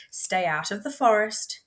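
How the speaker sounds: usual speaking voice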